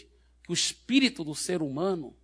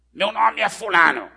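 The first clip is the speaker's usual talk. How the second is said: loud, higher demon voice; defiant demon; 4 semitones higher, 9 dB louder than usual. Retold or imitated. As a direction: hurl it out like an evil spirit refusing to leave. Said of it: "Só que o demônio que respondeu".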